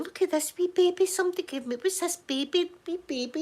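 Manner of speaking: put-on high, fast cooing over a baby doll; doting mum. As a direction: coo like a proud confused gran.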